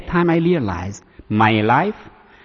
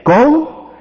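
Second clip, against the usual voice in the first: commanding go; 13 semitones higher, 7 dB louder than usual. Retold or imitated. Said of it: "He said".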